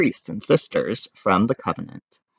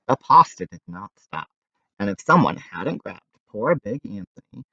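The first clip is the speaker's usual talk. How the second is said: usual speaking voice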